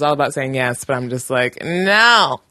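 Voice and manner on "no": sharp, louder no; firm no. Shown as a demonstration. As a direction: slam the door with no. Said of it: "I like to say no".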